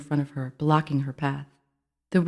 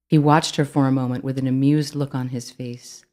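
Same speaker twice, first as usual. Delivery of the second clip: usual speaking voice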